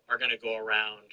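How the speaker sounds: ordinary talk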